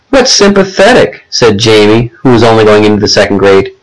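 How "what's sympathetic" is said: put-on high-pitched small boy; childlike puzzled question; puzzled little brother. A narrator's reading. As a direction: ask innocently, as a second grader. Second grader asks about a word.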